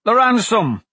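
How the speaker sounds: loud urgent shout. Acted. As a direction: yell out the warning. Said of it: "he shouted".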